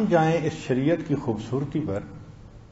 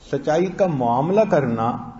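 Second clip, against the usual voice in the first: ordinary talk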